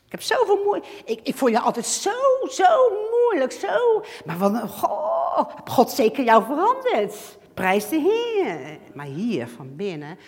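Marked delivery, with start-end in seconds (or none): 0.0-8.8 higher, gushing backhanded compliment; mock gushing praise. Church member praising her change, hammed-up gushing. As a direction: lay the sweetness on thick.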